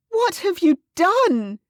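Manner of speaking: high, loud, slow anguished question; distraught cry. Acted. Clarice begging Louisa to explain, distraught. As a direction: plead in horror. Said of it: "Clarice cried, utterly distraught".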